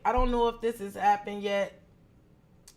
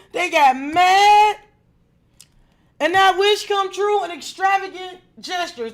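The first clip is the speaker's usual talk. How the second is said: rising from loud to a full shout, much higher and louder; building to a shout; 7 semitones higher, 9 dB louder than usual. Real, not acted. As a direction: get fired up about the jealous one and let it blow. Tarot reader builds to a loud climax.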